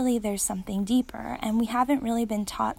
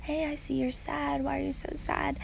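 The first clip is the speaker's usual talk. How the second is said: gently imitating a sweet dad, a bit higher and softer; sweet daddy; 6 semitones higher, 4 dB quieter than usual. Dad soothing his sobbing daughter, soft and higher. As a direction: coax her like a tender father. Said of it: "her daddy was being really sweet and like".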